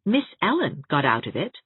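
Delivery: ordinary delivery, nothing marked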